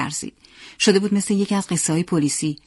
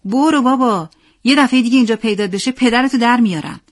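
loud, higher-pitched warning; angry threat; 5 semitones higher, 5 dB louder than usual. A narrator's reading. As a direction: drive him off with a raised voice. Boy shoves and threatens another. (تهدید با صدای بلند و عصبانی)

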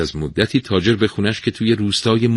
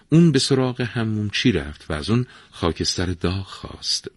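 ordinary delivery, nothing marked

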